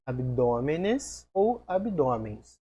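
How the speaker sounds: slow, clear "abdômenes ou abdômens" with "ou" stressed; slow contrast. Shown as a demonstration. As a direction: spell out both forms slowly. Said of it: "as duas possibilidades são aceitas". (devagar, destacando "abdômenes ou abdômens")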